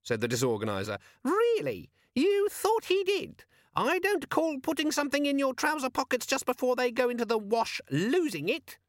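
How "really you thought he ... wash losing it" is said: very high, sarcastic squeal; scornful squeaky demon. A narrator's reading. Demon complaining of being left in the wash, aggrieved squeak.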